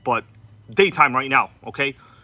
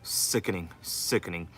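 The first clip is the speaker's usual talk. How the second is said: toned-down regular voice; 10 semitones lower, 12 dB quieter than usual. Shown as a demonstration. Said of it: "I'm gonna try to talk like a regular person".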